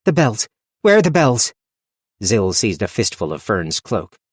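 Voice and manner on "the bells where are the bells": rising, louder sudden alarm. Acted. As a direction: suddenly realise and panic. Sudden alarm at missing sound.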